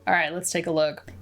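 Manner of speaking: ordinary talk, nothing marked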